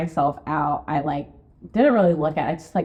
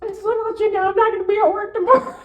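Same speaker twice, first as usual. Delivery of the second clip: high, fast, teary laugh-talk; laughing through tears. Retold or imitated. Calling David from the hospital, high teary laughter. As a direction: hold back crying while laughing, high up. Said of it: "I'm trying not to cry".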